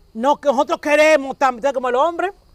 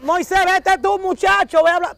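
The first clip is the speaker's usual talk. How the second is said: higher, hurried plea to Moses; the scared people urging Moses to go talk; mock nervous pushing; 6 semitones higher than usual. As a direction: shove the job onto him, half-panicked.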